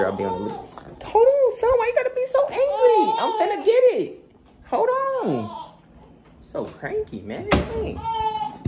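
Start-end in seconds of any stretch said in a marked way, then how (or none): 1.0-5.7 much higher and louder, laughing. Real, not acted. Laughing baby voice to son. 6.5-8.6 much higher, playful. Real, not acted. High baby talk to son.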